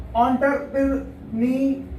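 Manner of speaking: एंटरप्रेन्योर slowly split as on-ter-pir-nyor; British एंटरप्रेन्योर with an "on" start, in pieces; British pronunciation. Shown as a demonstration. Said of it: "इसको British में बोलते हैं".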